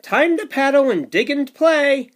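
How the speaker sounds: put-on lively read-aloud for little kids; sing-song storybook rhythm; bouncy picture book narration; reading to children.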